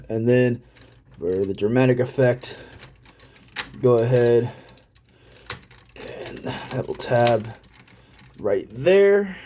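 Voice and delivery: ordinary talk, nothing marked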